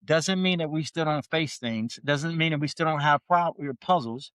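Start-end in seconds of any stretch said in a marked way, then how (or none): none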